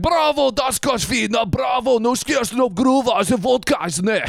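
hammed-up Russian crowd shout; mock Russian cheering. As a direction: bellow like a Soviet crowd in fake Russian. Imitates cheering Soviet crowd.

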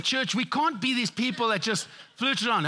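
ordinary talk, nothing marked

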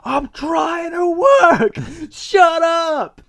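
high, drawn-out angry yell; acted furious shout. Husband snapping at noisy wife, furious shout. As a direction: shout it in a strained high pitch. Acted cartoon outburst at a partner.

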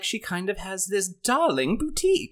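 a hint of Rarity's affected darling voice. Explaining which pony talks like that, lightly imitated affected voice. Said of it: "she's the one who talks like".

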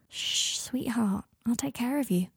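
soft, tender reassurance; soothing hush. Acted. Comforting a sweetheart, softly soothing. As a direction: hush and comfort them gently.

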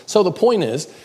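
preacher hammering "point"; emphatic point. Real, not acted. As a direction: punch the word point high and loud.

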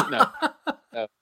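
normal talking voice

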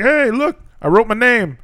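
put-on high, proud child voice; little kid voice. Teacher imitating a proud first grader, high kid voice. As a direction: show it off like an eager six-year-old. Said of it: "He's like".

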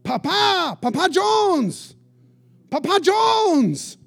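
calling across the room, high and loud, stretched slow; yelling a name. Retold or imitated. Man at a table calls the pastor across the airport, slow loud yell. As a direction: hail him from far back, drawn-out and loud. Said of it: "he starts yelling".

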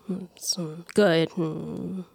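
soft, distracted mock mumble trailing into a whisper; barely answer, half lost in thought; mumbling doctor. Imitating the awkward doctor answering small talk in a soft, absent mumble. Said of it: "he would be like".

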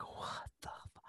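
breathy stunned curse, cut off; half-voiced cut-off curse; whispered shock. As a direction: mouth it in disbelief. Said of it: "I was like".

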